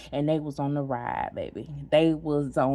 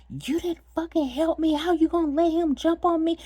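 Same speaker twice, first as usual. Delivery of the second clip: angry accusation; 11 semitones higher than usual. Retold or imitated. To girlfriend who didn't help, angry accusing. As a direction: hurl the blame at her.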